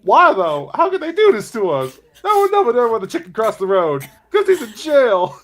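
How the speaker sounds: high, loud, hammed-up disbelief; mock outrage. Acting out the video guy's outraged reaction.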